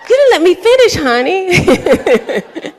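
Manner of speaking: bright high teasing call-out to the crowd; playful preacher teasing the congregation; playful tease. Real, not acted. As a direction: tease the crowd sweetly for jumping ahead.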